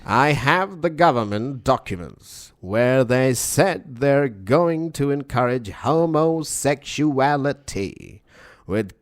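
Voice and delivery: slow, stretched mid-Atlantic announcer delivery; Alex Jones. Acted. Co-host quoting a chemical conspiracy, slow announcer-style impression. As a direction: proclaim it like an old-style American broadcaster. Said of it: "sounds like mid-Atlantic, old-style American".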